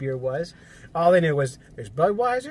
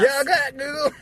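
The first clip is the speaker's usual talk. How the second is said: high, twangy Southern voice; hammed-up Southern drawl; mock southern accent; 11 semitones higher, slower than usual. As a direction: play a Jersey local as a Southerner. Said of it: "everyone in South Jersey has a southern, southern accent".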